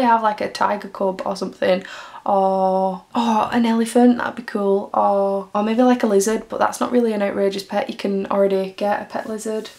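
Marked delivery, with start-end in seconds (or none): none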